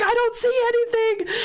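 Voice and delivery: high-pitched frantic denial; acted panicked reply. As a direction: imitate a mother caught up in panic. Mother answering her screaming child.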